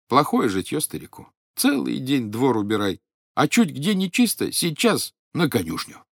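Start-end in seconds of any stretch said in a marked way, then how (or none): none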